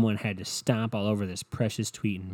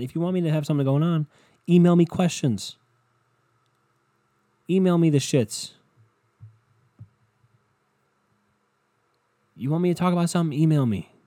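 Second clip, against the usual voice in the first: louder and higher, demanding emails; exasperated shout; 7 semitones higher, 6 dB louder than usual. Real, not acted. Podcaster yelling at listeners to email him, exasperated. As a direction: yell it at the listeners, half joking.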